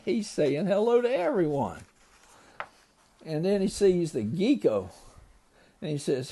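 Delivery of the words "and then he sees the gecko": ordinary delivery, nothing marked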